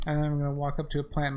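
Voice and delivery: usual speaking voice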